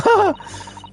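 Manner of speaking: high burst of laughter; laughing fit. Real, not acted. Streamer breaks up mid-read at the Russian joke, high laugh. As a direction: crack up helplessly.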